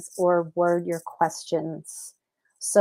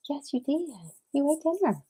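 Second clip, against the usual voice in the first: sweetly chiding a little animal; pet talk; 6 semitones higher, 6 dB quieter than usual. Real, not acted. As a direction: coo it to a pet, pitched way up. Teasing the pet about dinner.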